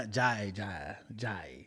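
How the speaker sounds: low, soft fake Spanish in an African-like accent; halting, hushed gibberish in an African-tinged accent; botched African-sounding accent. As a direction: mumble mock Spanish with a wandering African accent. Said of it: "it was African".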